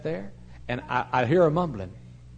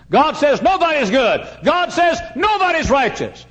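slow, high, thunderous pulpit cry; emphatic preaching; 11 dB louder, slower than usual. Acted. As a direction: hammer each phrase out to the back pews. Preaches at full force.

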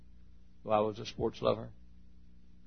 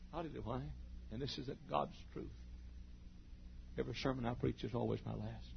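much softer, intimate admission; quiet sincere testimony; hushed confession; 14 dB quieter than usual. Real, not acted. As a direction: draw them close and speak softly. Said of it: "shift to confession".

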